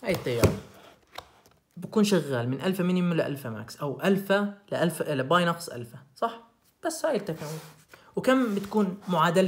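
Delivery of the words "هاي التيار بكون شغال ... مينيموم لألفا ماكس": ordinary talk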